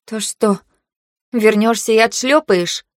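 biting, mocking retort; sarcastic. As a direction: spit the words out with sarcasm. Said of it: "процедила я язвительно".

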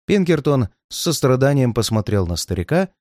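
ordinary delivery

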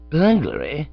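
rising, disbelieving repeat of "Burglary"; incredulous echo. Acted. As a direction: throw "Burglary?" back in astonishment. Bertie echoes in disbelief.

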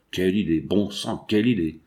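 low, mild grumble to himself; grumbling to himself. A narrator's reading. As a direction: grumble under it, half to yourself. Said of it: "Puis il grommela, pour lui-même". (grommelé pour lui-même)

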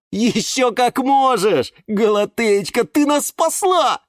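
loud, high, drawn-out praise; elated thanks. A narrator's reading. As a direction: gush with grateful delight. Восторженная благодарность орхидее. (восторженно, протяжно и громко)

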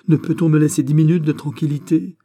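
high, loud complaint; exasperated cry. A narrator's reading. Governor interrupted by a sergeant, exasperated outburst. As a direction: burst out, demanding some peace. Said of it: "s'écria Besmo".

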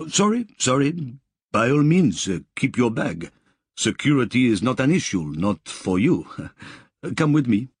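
a worker fussing over the VIP; Belgian accent. A narrator's reading. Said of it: "This man's accent was European, Belgian, perhaps".

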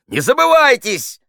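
very high, loud rebuke; outraged officer's cry; sharp shout. A narrator's reading. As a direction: snap a shouted warning at an insolent inferior. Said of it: "прикрикнул замполит".

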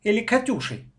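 high-pitched mocking follow-up; snide sibling needling. A narrator's reading. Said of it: "ехидно спросил я брата".

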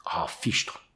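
breathless whispered oath, no voice at all; whispered exclamation. A narrator's reading. Narrator gasps at the panther, whispered oath. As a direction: gasp it out under your breath in awe.

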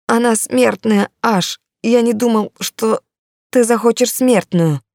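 high, slow and pleading; frightened pleading. Acted. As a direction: plead up high, dragging the words. Terrified orc pleads with demon.

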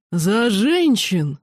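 put-on slow, slurred drunken toast. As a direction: raise a glass, sloppy drunk. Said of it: "совершенно пьяным голосом возвестил Сайер".

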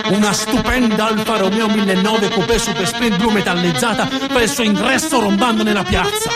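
ordinary delivery

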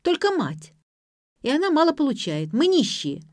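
high, combative granddaughter; going on attack. A narrator's reading. Granddaughter argues with grandmother about money, high and combative. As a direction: hit back in a high, heated voice. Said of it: "Внучка ринулась в атаку".

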